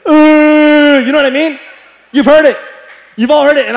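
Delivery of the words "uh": long high crying wail; drawn-out, high, loud fake bawl; loud mock wail. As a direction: imitate crying that grows louder. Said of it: "it just starts progressively getting louder".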